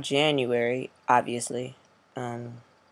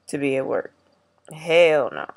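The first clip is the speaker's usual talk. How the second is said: usual speaking voice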